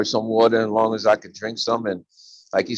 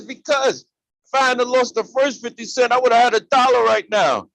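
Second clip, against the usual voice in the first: put-on higher childlike whine; little boy punchline; 11 semitones higher, 4 dB louder than usual. Little boy explaining to the man why he still cries, lightly whiny. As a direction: let the kid sulk out the punchline.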